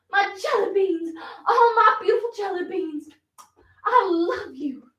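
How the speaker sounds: high, delighted belle greeting her admirers; showy, effusive delight; gushing call. Acted. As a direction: call out adoringly from the veranda. Calling to admirers from veranda.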